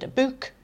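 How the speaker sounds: put-on "book" in her regional accent, high; own regional accent. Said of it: "I sort of had an accent".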